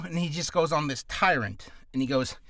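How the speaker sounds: ordinary talk, nothing marked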